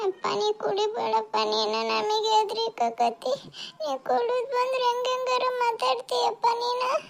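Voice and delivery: put-on high, slow little child's voice begging dad not to drink; child begging a drunk father to stop, high and slow; scared child pleading.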